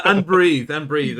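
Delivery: loud relieved exhalation, first take drawn out; big playful "finally finished" release; mock relief. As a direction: let it out loudly, easing off on the repeat. Mock relief after reading long list.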